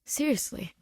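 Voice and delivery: plain reading voice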